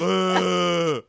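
loud, high mock roar; imitated monster bellow; beast roar. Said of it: "he's like a beast".

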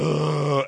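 a loud, disgusted ugh; mock disgusted grunt. Chad grunting at the housemate in disgust. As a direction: grunt it out like a caveman. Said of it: "So he's like".

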